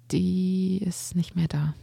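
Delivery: slow, whispered admission; barely able to say it; hushed grief. Acted. Boy admits his mother is gone.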